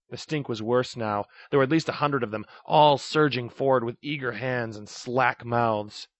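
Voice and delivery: plain reading voice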